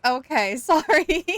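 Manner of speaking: high, loud, giggly sorry; laughing apology. Real, not acted. Streamer realizes chat wrote backwards, laughing apology. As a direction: apologize as the laugh takes over.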